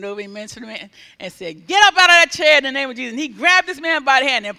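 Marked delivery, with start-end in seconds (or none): 1.7-3.1 loud, rapid healing command. Retold or imitated. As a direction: order the man up in faith. Healer commands man to rise.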